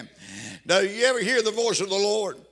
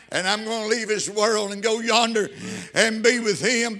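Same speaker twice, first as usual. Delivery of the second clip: fervent preaching; 3 semitones higher, 5 dB louder than usual. Acted. Preacher testifying to his church, fervent and building. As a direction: build the congregation up with rising fervor.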